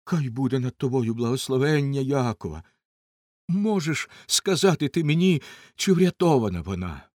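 put-on loud, urgent plea from an anxious old father; agitated old man. A narrator's reading. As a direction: plead at full voice, falling away at the end. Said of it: "тремтячи з хвилювання".

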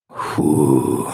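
low puffed exhale; out of breath. A narrator's reading. The hero stops after fleeing the giants, out of breath. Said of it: "захекано мовив В Д В".

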